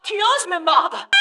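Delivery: high, drawn-out screech; outraged cry. Acted. Hostage taker to biting captive, outraged.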